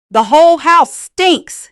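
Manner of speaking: high, loud, slow screamed accusation; retold scream. Son-in-law screaming at the old woman, slow loud scream. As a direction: scream it at her like he did. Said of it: "he had screamed at me".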